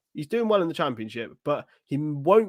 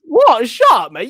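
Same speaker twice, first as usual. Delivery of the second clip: mock outrage; 8 semitones higher, 12 dB louder, slower than usual. Real, not acted.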